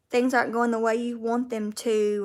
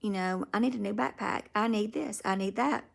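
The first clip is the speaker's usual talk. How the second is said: inner shopping voice; 8 dB quieter than usual. Retold or imitated. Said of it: "I'll be like".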